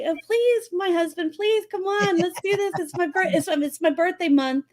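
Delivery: sweet-talking mock plea; playful pleading. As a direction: coax your husband, mock begging. Acts out pleading with husband.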